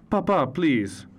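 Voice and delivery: high, slow drawn-out plea; mother's pleading shout. A narrator's reading. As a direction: stop your father mid-story. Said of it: "my mother shouted".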